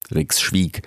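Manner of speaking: plain reading voice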